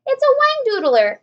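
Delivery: high, loud alarmed yell; fire chief yell. A narrator's reading. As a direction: yell the monster name. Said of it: "yelled the head of the fire department".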